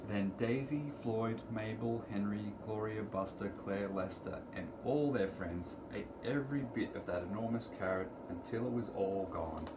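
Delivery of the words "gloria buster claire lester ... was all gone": ordinary delivery, nothing marked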